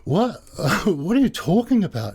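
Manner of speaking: high, stunned disbelief with a laugh; incredulous protest. Retold or imitated. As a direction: laugh it off in shock, pitch way up. Arrested girl protests in disbelief.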